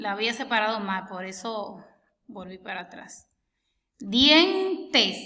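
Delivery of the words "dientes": dientes said louder and higher, split into syllables; syllable demo. Shown as a demonstration. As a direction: say dientes as a loud syllable model. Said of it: "Tenemos la I y la E que quedaron en la misma sílaba". (dientes dicho fuerte y agudo, separando sílabas)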